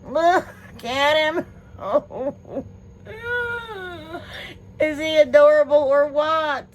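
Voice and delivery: high, loud gushing with a giggle; thrilled squeal at an adorable present; squealing delight. Real, not acted. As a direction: coo over a cute little gift. Gushing over a cute gift.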